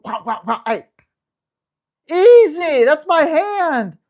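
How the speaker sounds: loud startled correction, slowing at the end; startled yelp at dog. Retold or imitated. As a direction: snap at the dog grabbing your hand. Hand bitten while feeding dog.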